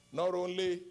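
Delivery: usual speaking voice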